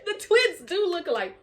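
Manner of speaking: high voice breaking into laughter; laughing. Real, not acted. Teller repeats the twins discovery after cracking up, laughing. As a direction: repeat it helplessly amused.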